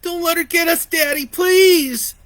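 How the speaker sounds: high loud crying plea; terrified pleading. A narrator's reading. Boy clinging to his father, pleading in terror. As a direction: beg your dad to protect you. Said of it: "he pleaded".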